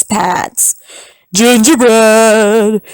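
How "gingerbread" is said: slow javelina shout. Acted. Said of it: "shouted the javelinas".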